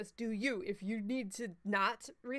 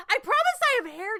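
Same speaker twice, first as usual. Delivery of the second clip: excited protest; 13 semitones higher, 10 dB louder, faster than usual. Real, not acted. Jokey protest.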